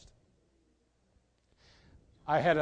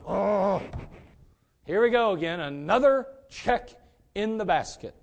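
loud, exasperated groaning complaint, higher pitched; grudging; 5 semitones higher, 5 dB louder than usual. Acted. Preacher mimics a reluctant giver dropping in a check, grudging. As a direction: grumble it out loudly, heavy on the reluctance. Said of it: "doesn't want you to give grudgingly".

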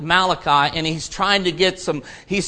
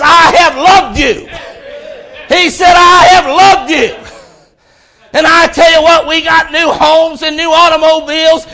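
high, loud, impassioned shouting sermon; preaching at full force; 8 semitones higher, 8 dB louder than usual. Acted. Preacher rebuking complainers, shouting with fervor. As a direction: hammer it home to the congregation.

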